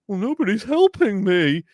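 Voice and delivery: high, loud whiny imitation; mock whining. Host mocking actor's complaint about coaching, high whine. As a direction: wail it like a sulky, helpless complainer.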